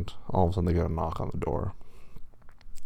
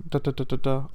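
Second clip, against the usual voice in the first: quick "da-da-da" knocks, higher pitched; imitated knocking; 5 semitones higher, 4 dB louder than usual. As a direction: rap out knocking sounds with the voice.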